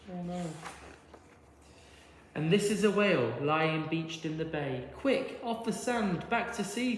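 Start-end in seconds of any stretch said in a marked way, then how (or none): 5.0-7.0 urgent cry. A narrator's reading. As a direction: plead with the stranded whale to move. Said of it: "cried the snail".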